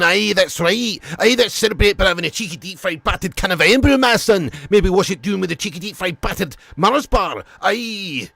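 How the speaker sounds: put-on lightly done Scottish accent, jokey; a hint of a cheery Scottish lad; generic Scotsman. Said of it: "can do a generic Scotsman".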